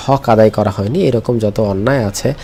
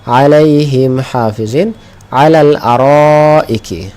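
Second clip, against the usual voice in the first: slow, louder chanted Arabic phrase; ধীর তিলাওয়াত; slow recitation; 3 semitones higher, 5 dB louder, much slower than usual. Shown as a demonstration. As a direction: intone each word of the verse slowly. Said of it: "রটাকে খুঁজে বের করব".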